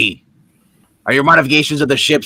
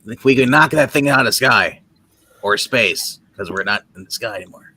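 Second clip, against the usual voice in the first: ordinary talk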